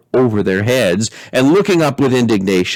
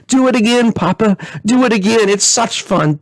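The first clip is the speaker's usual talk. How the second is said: gleeful crying out; 11 semitones higher than usual. Acted. Princess cheering on her storming papa, high and gleeful. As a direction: beg for more like a thrilled child. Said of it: "clapping her hands and crying".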